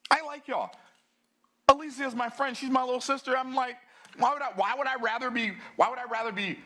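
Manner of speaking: high, fired-up rant; getting carried away with passion; worked up. Real, not acted. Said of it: "you got me all worked up".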